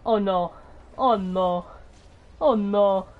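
low, drawn-out groan of dread; real low-voiced dismay; sinking dismay. Real, not acted. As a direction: pitch drops as the hope goes. Dread as the game turns bad.

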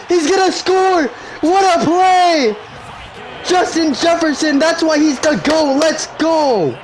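very high, screaming play-by-play; ecstatic shouting. Real, not acted. Gamer calling Jefferson's touchdown run, ecstatic shouting. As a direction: yell the run all the way to the end zone.

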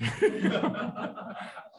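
airy laugh, pitched high; amused laugh at the Russia story; high breathless laugh. Real, not acted. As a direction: let out a high, airless chuckle.

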